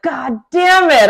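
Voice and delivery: loud, high, drawn-out exasperation; frustrated outburst. Retold or imitated. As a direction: replay your frustration, stretching it out loudly. Retold frustration at trivia game.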